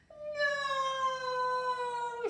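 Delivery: put-on high, loud little girl's no; Prunella refusing. A narrator's reading. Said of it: "said Prunella".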